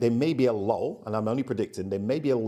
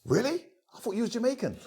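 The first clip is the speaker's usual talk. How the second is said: high, incredulous really; mock surprise; 14 semitones higher than usual. Festival guy surprised Ian dislikes it, high incredulous. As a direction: act the stunned fan.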